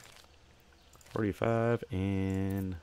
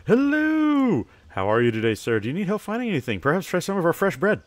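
high bright sing-song shop greeting, louder; cheery shopkeeper; 9 semitones higher, 6 dB louder than usual. Acted. Shopkeeper hailing a customer entering the store, cheery and loud. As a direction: welcome customers like an eager shopkeeper.

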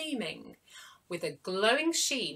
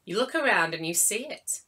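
ordinary delivery

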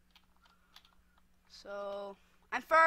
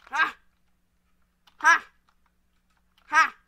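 short, loud, separate bursts; punchy "ha"; 4 semitones higher, 14 dB louder, slower than usual. Real, not acted. Player reacting to each move, loud punchy ha. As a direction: shout each "ha" as a single burst. Exclaims during game moves.

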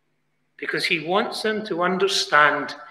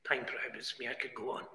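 very soft, low-pitched aside; hushed aside; 7 semitones lower, 20 dB quieter, faster than usual. Real, not acted. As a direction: drop right down as if remarking to yourself. Hushed aside during loud preaching.

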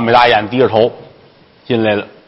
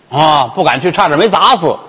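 plain reading voice

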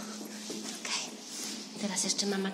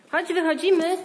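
calling out; 8 semitones higher, 6 dB louder than usual. Real, not acted. Mother calling toddler to leave.

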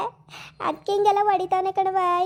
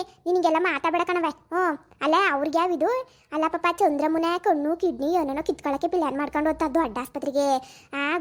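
usual speaking voice

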